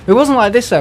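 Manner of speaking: high, loud denial; emphatic. Real, not acted. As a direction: push back hard on the idea. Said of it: "denies ranting".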